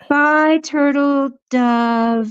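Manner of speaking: long, lingering sing-out of the name; drawn-out farewell. Real, not acted. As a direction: stretch the goodbye call right out. Calling out farewells to chat members.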